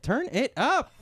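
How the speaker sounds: high-pitched party demand; excited seniors. Acted. Seniors wanting their jam turned up, high excited call. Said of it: "They're like".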